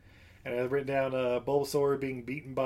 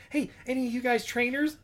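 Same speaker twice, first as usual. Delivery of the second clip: put-on girl voice; 9 semitones higher than usual. Girl approaching the heroes.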